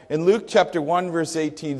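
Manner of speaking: ordinary talk, nothing marked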